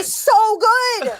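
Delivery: high, slow, delighted gush. Real, not acted. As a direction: stretch it out, thrilled. Gushing over a favorite card.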